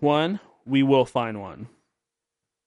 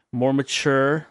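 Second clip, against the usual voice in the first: usual speaking voice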